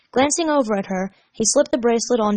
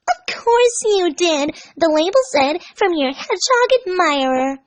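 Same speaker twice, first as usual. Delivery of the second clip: Amy voice; 9 semitones higher, 4 dB louder than usual. Acted. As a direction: sweetly insist in a high girl voice.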